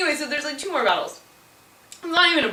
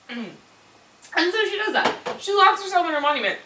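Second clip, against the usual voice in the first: ordinary delivery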